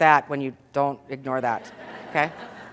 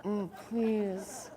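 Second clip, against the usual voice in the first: scornful husband; 10 dB quieter than usual. Retold or imitated. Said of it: "my husband's like".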